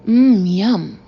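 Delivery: loud, defiant show of enjoyment; exaggerated relish. Boss cramming muffins in front of staff, exaggerated relish. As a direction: make a big show of savouring it.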